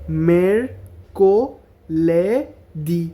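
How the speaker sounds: very slow broken syllables; মেরকোলেদি split into slow syllables. Shown as a demonstration. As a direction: say মেরকোলেদি as mer-ko-le-di. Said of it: "এভাবে syllable ভাঙা ভাঙা".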